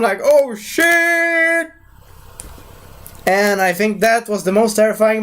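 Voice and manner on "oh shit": high, drawn-out wail of dismay; mock dread. Viewer reacting to Stan Lee's threat, hammed-up dread. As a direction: stretch it out into a high cry.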